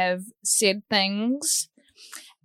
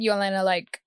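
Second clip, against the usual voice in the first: usual speaking voice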